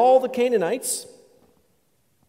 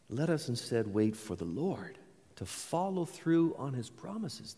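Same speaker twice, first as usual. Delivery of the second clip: hushed sermon close; 7 semitones lower, 10 dB quieter than usual. Acted. Preacher softly assuring the congregation of God's care. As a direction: speak it low and close, like a quiet blessing.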